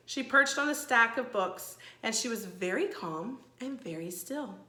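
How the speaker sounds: plain reading voice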